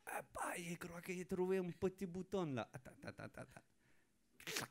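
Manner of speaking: whispered discovery. Acted. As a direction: hush it like a secret find. Penguin finds the button.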